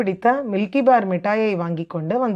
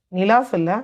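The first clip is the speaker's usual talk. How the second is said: plain reading voice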